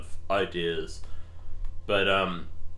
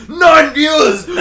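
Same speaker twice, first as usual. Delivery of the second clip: high, slow, shouted announcement; excited shout; 17 dB louder, much slower than usual. Real, not acted. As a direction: yell the count out, thrilled. Shouting at viewer count.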